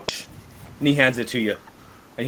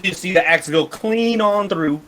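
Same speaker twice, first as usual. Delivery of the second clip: ordinary talk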